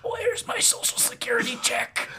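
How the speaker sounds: put-on very high, cracking old lady voice. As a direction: play a cranky old lady. Old ghoul voice.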